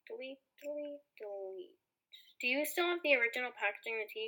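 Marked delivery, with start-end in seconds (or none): 0.1-1.8 soft, absent-minded aside; talking to oneself. Acted. Support agent deleting to himself, much softer. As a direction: say it under your breath while working.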